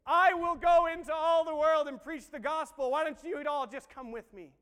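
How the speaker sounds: high, very loud slow shout, easing at the end; booming mock declaration; mock proclamation. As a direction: proclaim it grandly as a mock Jesus. Booming what Jesus did not say.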